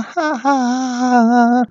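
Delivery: high, long villains' guffaw; roaring mock laughter. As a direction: burst into a staged high-pitched group laugh. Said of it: "同时就哄堂大笑".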